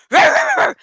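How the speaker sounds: loud, high roaring growl; angry wolf roar. Retold or imitated. Wolf enraged by the song, roaring loudly. The wolf looked even angrier.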